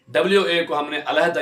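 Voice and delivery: ordinary talk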